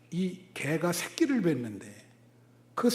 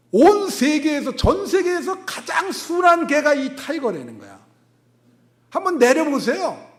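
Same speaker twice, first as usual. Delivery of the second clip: incredulous shout; 8 semitones higher, 9 dB louder than usual. Retold or imitated. Retelling disbelief at gentle dog claim.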